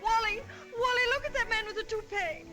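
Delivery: high, eager call; excited calling. Acted. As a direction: call out with delight.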